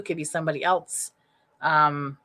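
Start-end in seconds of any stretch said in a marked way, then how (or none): 1.6-2.2 hiccups. Real, not acted. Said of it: "and I'm hiccuping".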